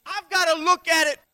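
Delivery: loud pulpit declaration; preaching loud. Acted. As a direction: declare it to the room.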